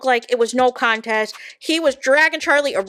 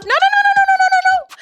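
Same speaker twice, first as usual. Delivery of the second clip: high, loud string of no's; mock shouted no; 5 dB louder, much faster than usual. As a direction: shout her down, higher and louder. Imagined rant at Lucinda.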